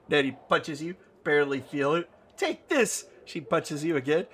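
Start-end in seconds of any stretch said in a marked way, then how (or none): 2.4-3.1 very high, squeaky battle yelp; feisty attack cry. Acted. Nanny punches the player playfully, high and feisty. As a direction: cry out with each harmless punch.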